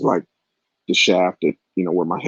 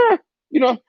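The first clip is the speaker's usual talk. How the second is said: dismissive shrug; faster than usual. Retold or imitated. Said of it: "her attitude was so".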